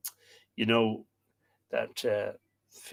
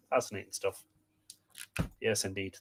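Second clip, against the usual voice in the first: usual speaking voice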